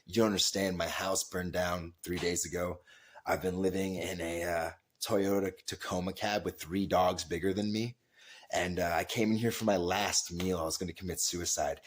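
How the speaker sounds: low, soft, subdued retelling of a tearful woman; hushed imitation of someone upset; crying customer. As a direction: quietly voice her confession, understated. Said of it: "she's crying".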